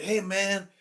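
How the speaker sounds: a hint of a student taunting the teacher. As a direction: lightly imitate a smug kid. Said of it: "He said".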